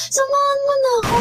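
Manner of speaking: whiny worried friend. Retold or imitated. Friend begs to go along.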